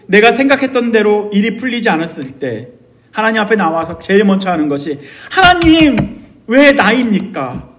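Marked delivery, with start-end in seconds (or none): 5.3-7.7 high, loud call, then drawn-out protest; resentful prayer. Acted. Pastor praying when things go wrong, resentful cry. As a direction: call out to God and demand a reason. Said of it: "이렇게 원망의 기도를 하기도 합니다".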